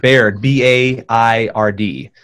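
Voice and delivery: slow, letter-by-letter spelling. Shown as a demonstration. Guest spelling the surname for viewers, slow and distinct. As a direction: say the name, then space out each letter. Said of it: "Say the name again slowly, [name]".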